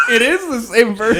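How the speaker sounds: high shouted laugh into the words; real laughing yell. Real, not acted. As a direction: yell it while cracking up.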